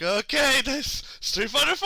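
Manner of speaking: high, loud nervous brightness; strained cheer. Retold or imitated. Watching the clerk test the Xbox, high forced cheer. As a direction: cover panic with forced cheer.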